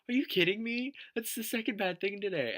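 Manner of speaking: very high, incredulous squeal; retold disbelief. Retelling the reply to mom's bad news, high-pitched disbelief. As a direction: pitch it way up in mock exasperation.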